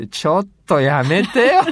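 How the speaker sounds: high, loud, laughing "cut it out"; mock protest. Mock reaction to pretentious pronunciation. (大げさに嫌がるツッコミ)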